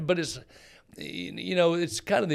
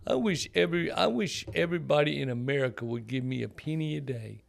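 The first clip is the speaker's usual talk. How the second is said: clumsy Indian accent impression; imitating an Indian friend's accent; 3 semitones higher than usual. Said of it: "That's a horrible Indian accent".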